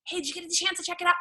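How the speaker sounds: quick check-in. Shown as a demonstration. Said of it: "I send a voice message".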